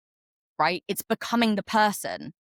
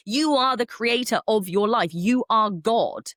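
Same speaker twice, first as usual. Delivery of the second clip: real swell of emotion, louder and slowing right down; emotional; 3 semitones higher, 5 dB louder, slower than usual. Real, not acted. Podcast host telling listeners they are God, emotional. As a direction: declare it slowly, overcome with conviction. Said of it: "I'm getting emotional about this".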